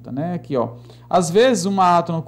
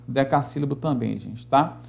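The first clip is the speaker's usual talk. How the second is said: ordinary talk, nothing marked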